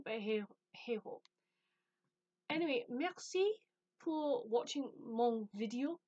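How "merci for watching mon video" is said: put-on playful, clumsy French accent; mock French. Thanking viewers in minuscule French, mock French accent. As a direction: slip into schoolgirl French, badly faked. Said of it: "my French is, like, minuscule".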